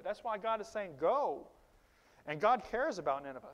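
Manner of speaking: loud, rising preacher's call; fiery preaching. Acted. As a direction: preach it out to the congregation. Preaching at full force.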